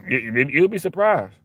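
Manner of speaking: ordinary talk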